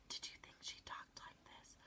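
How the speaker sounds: breathy whisper, very soft. Shown as a demonstration. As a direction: whisper it like a secret. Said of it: "she was like this?".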